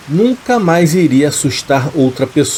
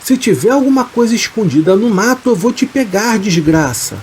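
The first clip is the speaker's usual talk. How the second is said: threatening shout; 7 semitones higher than usual. A narrator's reading. Fidêncio threatens whatever hides in the bush, shouting high. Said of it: "começou a gritar, ameaçando".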